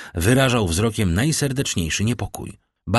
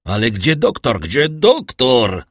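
louder, slowing into a drawn-out cry; desperate shouting; 4 semitones higher, 6 dB louder, slower than usual. A narrator's reading. Villefort shouts for the doctor in despair. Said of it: "wołał Villefort".

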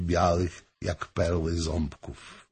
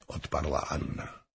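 ordinary delivery, nothing marked

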